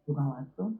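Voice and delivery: low, soft husband's reply; husband's quiet answer. Retold or imitated. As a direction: answer at her tiniest volume. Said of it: "내 그 제일 작은 톤에 맞춰서".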